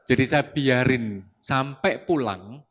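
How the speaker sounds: normal talking voice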